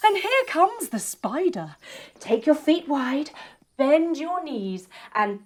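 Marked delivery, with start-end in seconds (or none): none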